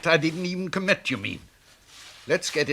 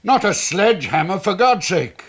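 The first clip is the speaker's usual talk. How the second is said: higher, louder outcry; alarmed protest; 6 semitones higher, 4 dB louder than usual. Acted. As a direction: stop them wrecking things. Owner protests at police search.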